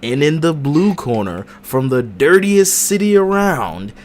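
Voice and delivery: high, loud, slow boxing ring announcer. Acted. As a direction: drag out "West Philly" for the crowd. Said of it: "I'm your referee announcer".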